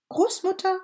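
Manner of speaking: put-on high, loud call of a little girl. A narrator's reading. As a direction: call into the house for grandma. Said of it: "rief es".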